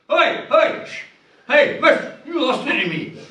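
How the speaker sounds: high, loud call-outs to the teacher; kids calling out. Acted. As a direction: shout over the class for miss. Pupils calling out.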